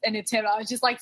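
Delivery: usual speaking voice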